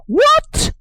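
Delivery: very high startled what; sharp what. Acted. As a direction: snap the word out, shocked. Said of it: "This time the syllable was edged".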